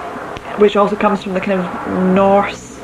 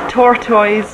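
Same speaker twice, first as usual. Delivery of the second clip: ordinary talk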